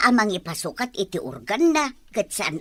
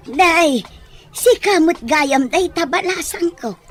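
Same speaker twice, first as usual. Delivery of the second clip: delighted greeting; 6 semitones higher, 6 dB louder than usual. Acted. Nana Esther greets girl happily.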